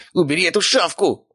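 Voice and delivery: high-pitched desperate yell. A narrator's reading. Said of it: "орал Безродный".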